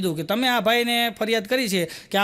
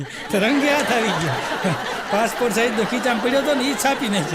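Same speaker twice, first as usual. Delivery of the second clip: laughing. Real, not acted. Laughing at own joke.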